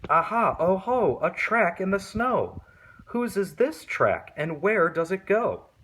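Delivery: put-on high, excited child's voice; Gruffalo's child. Child spotting a track in the snow, high and excited. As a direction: chirp it out like a little monster.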